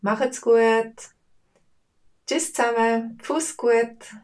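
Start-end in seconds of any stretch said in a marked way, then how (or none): none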